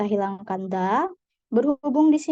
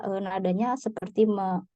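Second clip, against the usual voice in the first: normal talking voice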